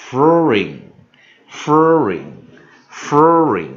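furring said slowly and loudly, each syllable stretched; slow drawn-out demo. Shown as a demonstration. Teacher drilling the class on furring, slow and loud. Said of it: "所以furring".

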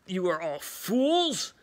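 slow, drawn-out mock villain sneer; lofty, drawn-out mockery. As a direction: scoff down at the fools, drawing it out. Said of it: "laughing, uh, saying".

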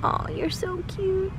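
high, slow doting coo. Real, not acted. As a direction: melt over the cute dog. Cooing at the dog.

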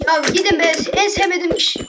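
real annoyance at pests; annoyed outburst. Real, not acted. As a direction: shoo them away, irritated. Annoyed at slimes.

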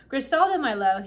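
loud, high exclamation to a loved one; tiny-voiced shout. A narrator's reading. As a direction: cry out the name, small but forceful. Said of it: "he shouted in his tiny voice".

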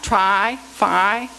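"try, fi" as two separate held syllables; long I sound. Shown as a demonstration. Teacher showing two linked words share a vowel, long I sound. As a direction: isolate and stretch the I in "try" and "fi". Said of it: "They both have long I sound".

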